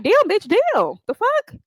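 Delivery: high, sarcastic jab, then fast; mocking disbelief. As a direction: scoff at her like she's shameless. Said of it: "I'm like".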